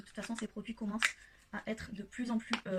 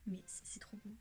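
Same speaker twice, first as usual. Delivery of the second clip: whispered under her breath in awe; hushed awe; 13 dB quieter than usual. Real, not acted. Vlogger marvelling at a pigment in a near-whisper. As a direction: breathe it out, almost voiceless. Admiring glittery pigment on camera.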